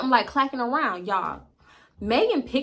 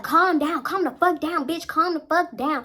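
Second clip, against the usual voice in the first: higher-pitched, repeated urging to calm down; mock insistent order; 6 semitones higher than usual. Friend urging her to calm down outside, insistent.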